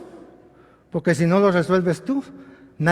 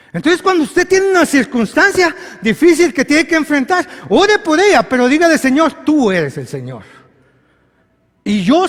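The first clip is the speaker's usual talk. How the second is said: higher, louder exhortation; full-force preaching; 6 semitones higher, 6 dB louder than usual. Acted. Preacher urging the church to pray, full force. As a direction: urge them to pray, full voice.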